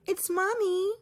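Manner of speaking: high, eager mom announcing herself; proud mom calling across the class; calling mother. Acted. Mother calling to daughter at school, high and eager. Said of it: "Ming cried".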